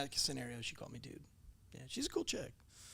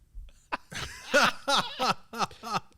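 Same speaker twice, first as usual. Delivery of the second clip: a loud burst of laughter; laughing out loud mid-story; 9 semitones higher, 10 dB louder than usual. Real, not acted. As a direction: break into a big laugh.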